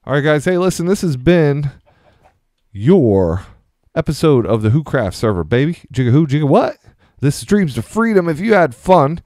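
high, bright YouTuber sign-off; hyped outro. Acted. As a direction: pump up the channel outro.

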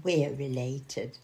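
a bit smug. Pretend. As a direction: say it lightly pleased with yourself. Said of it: "like a bit smug".

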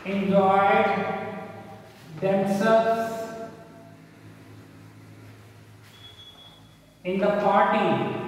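slow, word by word with long pauses; dictating. Shown as a demonstration. Teacher writing an example for the class, slow dictation. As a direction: spell out the sentence piece by piece.

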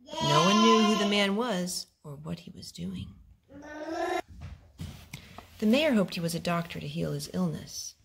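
usual speaking voice

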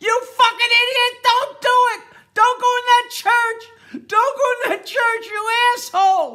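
full-force high-pitched shout; yelling at the screen. Acted. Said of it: "She's yelling at the screen".

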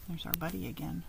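plain reading voice

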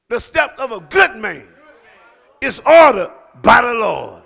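shouted scripture. Acted. Pastor proclaiming scripture to the church, shouting. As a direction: proclaim the verse to the rafters. Same verse earlier read softly.

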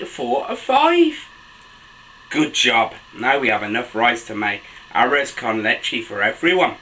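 put-on animated children's story reading, high excited exclamations; picture-book narration. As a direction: read to little ones, pitch leaping between narration and exclamations. Reads picture book aloud to children.

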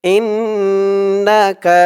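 ইন্নাকা with held nasal ইন; nasal inn. Shown as a demonstration. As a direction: hum the ন্ন of ইন্নাকা. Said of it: "ইন বলে গুণনা করতে হবে".

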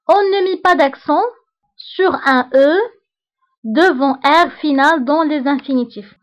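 slow, deliberate dictation of a rule; dictating to a class. Shown as a demonstration. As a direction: read it out slowly so students can write. Teacher dictates French rule.

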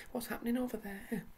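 high, startled question; surprised. Acted. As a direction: ask in wide-eyed surprise. Child startled by strange sight.

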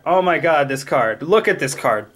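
ordinary talk

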